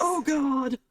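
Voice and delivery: high, exasperated groan of a dwarf; a worried barkeep sighing at trouble; dismayed dwarf barkeep. Acted. Will dismayed again.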